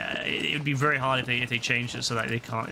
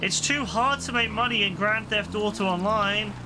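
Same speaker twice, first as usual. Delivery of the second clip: higher, drawn-out mock whine; mock exasperation; 5 semitones higher, slower than usual. Streamer moaning it's too hard to make money, mock exasperation. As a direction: complain with hammed-up, long-stretched words.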